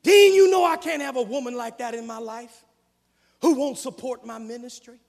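loud, rising preacher's cadence; full-force preaching. Real, not acted. Preacher pressing the point on the congregation, full force. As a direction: drive it home from the pulpit.

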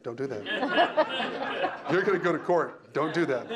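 laughing through don't do that; laughing warning. Real, not acted. Speaker laughing at the odd idea of praising adults, laughing. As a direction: giggle while waving them off.

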